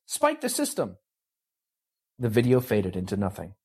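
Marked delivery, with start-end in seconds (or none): 0.1-1.0 yelling. Acted. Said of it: "Carol yelled".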